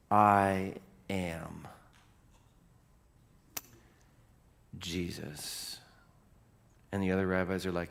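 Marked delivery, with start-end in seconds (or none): 0.1-5.8 slow, long pauses, fading to soft; solemn revelation. Acted. Preacher as the voice naming itself, slow hushed solemnity. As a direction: let each part hang, then soften.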